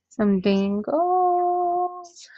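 high and very slow, stretching every sound; singsong coo. Real, not acted. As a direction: croon the words like a delighted coo.

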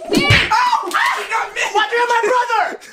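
high laughing protest; laughing yell. Real, not acted. Laughing after being hit.

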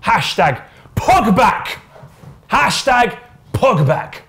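slow, high drawn-out Hashtag Pogback; high slow hashtag call; drawn-out catchphrase. Acted. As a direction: stretch the hashtag out like a slogan.

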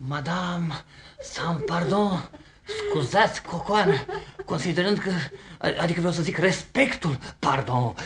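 halting, stammered excuses; embarrassed, faltering backpedal; flustered apology. Acted. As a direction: grope for words with pauses after each fragment. Sees wrong woman, apologises confusedly.

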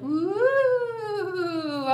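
long rising ghostly ooh; a ghost's moaning ooh; spooky ooh. Shown as a demonstration. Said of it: "say a really scary sound like".

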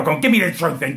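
ordinary talk, nothing marked

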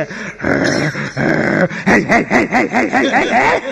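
barked heads, rising and falling; fed-up dog barks. Retold or imitated. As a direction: bark like a bored old dog. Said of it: "એના બાપા પછી કંટાળીને કે". (કંટાળેલા કૂતરાનું ભસવું)

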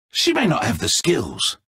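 ordinary delivery

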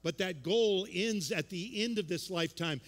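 rising, urgent sermon fervour; impassioned preaching. Real, not acted. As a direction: build to a loud, emphatic declaration of focus. Preaches at full force.

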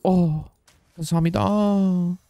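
slow put-on voice rising very high; silly exclamation. Streamer exclaiming a card name in a mock voice. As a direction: drawl the name then soar up to a falsetto.

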